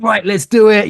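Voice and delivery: high, loud pumped-up rally cry; energetic wake-up coach shout; Red Bull energy. Retold or imitated. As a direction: fire up the room like a hype coach. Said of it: "human Red Bull".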